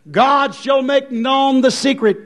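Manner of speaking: ordinary delivery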